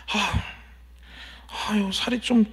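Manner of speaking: mock a mild fret, opening on a sigh; imitated complaint. Wife fretting over her weight, imitated complaint. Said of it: "하면서 불평하는 거예요".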